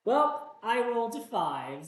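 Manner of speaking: ordinary talk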